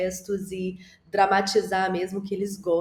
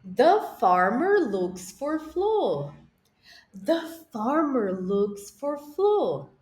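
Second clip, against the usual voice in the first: slow model sentence; 3 semitones higher, much slower than usual. Shown as a demonstration. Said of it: "Vamos fazer junto agora?".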